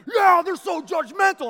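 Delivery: high, very loud angry yell; imitating a furious rant; mad. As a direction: burst out shouting in hammed-up outrage. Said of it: "Oh, he's mad again".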